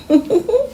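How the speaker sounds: squeaky high laugh; high giggle. Acted. Kid finishing with a delighted giggle. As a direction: end on a giggle.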